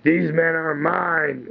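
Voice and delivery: slow, deliberate mock Batman growl; lightly voiced stern Batman; Batman claiming them. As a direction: lay claim to them slowly and firmly. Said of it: "Batman's like".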